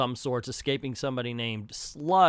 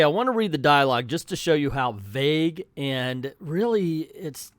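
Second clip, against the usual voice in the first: ordinary talk, nothing marked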